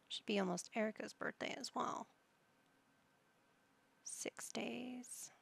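whisper. Real, not acted. Aside while playing, whispered.

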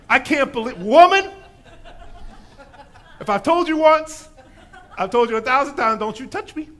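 mock angry scolding through laughter; mock furious yell. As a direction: fake a scolding rant for a joke. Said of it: "Jesus is just so mad at her".

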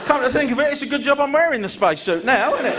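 high, strained, laughing voice; high-pitched frightened astronaut; panicked astronaut. Acted. Panicked astronaut after bang.

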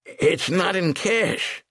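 high, slow and sheepish; frail old bookmaker. Acted. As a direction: admit it reluctantly.

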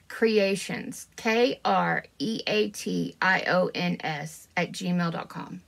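slow, deliberate letter-by-letter spelling; careful spelling out. Real, not acted. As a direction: spell it out slowly to get it right. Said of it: "Let me get it right".

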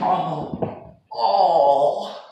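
pained cry. Real, not acted. Hurt while doing a trick.

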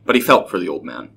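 plain reading voice

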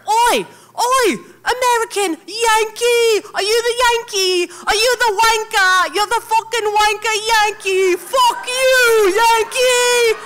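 high, loud, jeering shout of a Yorkshire lad; aggressive taunting yell. Retold or imitated. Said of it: "so much more aggressive than I could have ever imagined".

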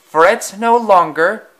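high, slow, grand declaration; pompous engine declaring. A narrator's reading. Boastful engine announcing to the station. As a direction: proclaim it like a vain hero. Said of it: "declared eight seven five four six".

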